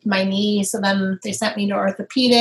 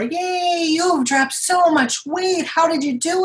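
high, slow cheering praise of a doctor; mock cheery doctor; 7 semitones higher than usual. Patient imitates doctor praising her weight loss, high and gushing. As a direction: gush like an overexcited doctor. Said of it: "she applauded me".

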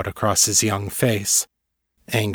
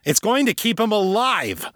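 high, heated snap back; angry father, pitch jumped up; snapping; 12 semitones higher than usual. A narrator's reading. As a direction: snap at her in self-defence. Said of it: "Father snapped".

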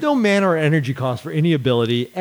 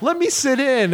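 high, laughing mock interviewer; mock pushy interviewer; 12 semitones higher than usual. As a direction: ham up a nosy broadcaster. Mocking interviewer during live match.

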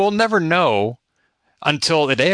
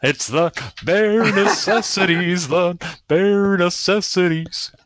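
slow, higher crooning cartoon bear; 4 semitones higher, slower than usual. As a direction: croon it lazily like a jungle bear. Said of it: "that's my bear voice".

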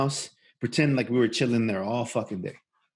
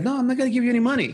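fast high refusal; 9 semitones higher, much faster than usual. Retold or imitated. Friend refusing to pay the extorting driver, fast high protest. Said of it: "he's like".

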